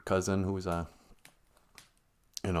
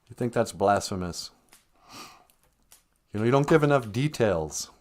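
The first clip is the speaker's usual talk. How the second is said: ordinary delivery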